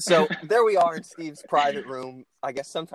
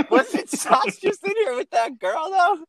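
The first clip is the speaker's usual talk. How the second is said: laughing; 4 dB louder, slower than usual. Retold or imitated. Own quote, laughing.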